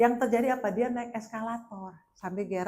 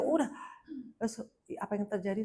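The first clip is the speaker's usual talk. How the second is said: usual speaking voice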